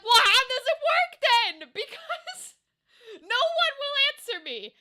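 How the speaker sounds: high exasperated wail. Retold or imitated. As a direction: plead in mock despair. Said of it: "I'm like".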